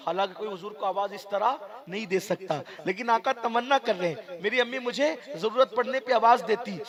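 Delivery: normal talking voice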